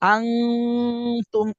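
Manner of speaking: আনতুম with the n as a long nasal ng hum; আনতুম drawn out slowly through the nose; ghunnah. Shown as a demonstration. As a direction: hold the hum in আনতুম, then release tum. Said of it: "গুণনাও করতে হবে।".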